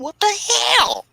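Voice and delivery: very high, slow squeak of confusion; squeaky puzzled voice. Acted. Dunham reacting to a fan in a very high squeak. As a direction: squeal it in disbelief. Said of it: "And he'd be saying".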